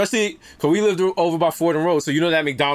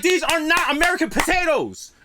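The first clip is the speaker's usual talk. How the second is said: pitched way up in disbelief; high, mock-shocked protest; incredulous; 9 semitones higher than usual. Son protesting his mother's homemade fries, high disbelief. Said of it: "I'm like".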